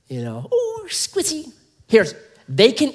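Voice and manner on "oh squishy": high, soft cutesy coo; pet cooing. Acted. Preacher imagines fussing over his fluffy dog, high soft cooing.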